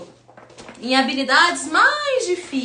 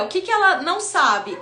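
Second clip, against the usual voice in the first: usual speaking voice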